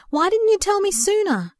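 hurt anger bursting out; stormy outburst. A narrator's reading. Boyfriend confronting Verity at her bedside, loud and hurt. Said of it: "he exclaimed stormily".

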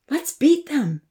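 high, mischievous challenge; impish. A narrator's reading. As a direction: tempt her friends into a race. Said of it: "Amy said impishly".

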